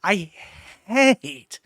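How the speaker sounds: loud, slow, seething; real anger, every word hammered; angry outburst. Real, not acted. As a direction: spit it out, slow and furious. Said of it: "I actually get genuinely angry".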